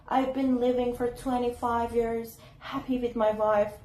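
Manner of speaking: lightly mimicked boastful family man; relative giving advice. Divorced relative advising people on marriage, lightly imitated. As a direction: recite it like a self-satisfied husband. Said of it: "he always say".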